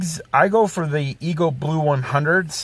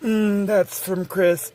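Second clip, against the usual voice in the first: slow, higher creepy murmur; 5 semitones higher, slower than usual. Pretend. Voice for viewer passing a kiss to Zach, creepy and slow. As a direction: make it sound a little creepy and lingering. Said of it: "Make sure you do it in a creepy voice".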